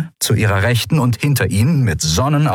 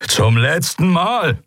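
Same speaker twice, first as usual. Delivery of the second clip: slow, higher-pitched final warning; 9 semitones higher, slower than usual. A narrator's reading. Commander to pirates who hold on to weapons, warning. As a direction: draw out a last threat.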